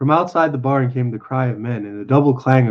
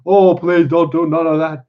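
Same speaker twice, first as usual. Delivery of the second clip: raised, pleading cry; begging; 4 semitones higher, 4 dB louder than usual. Acted. Simple man begs woman to stop screaming, panicked plea. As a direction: beg in a panic, pitched up. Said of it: "he begged".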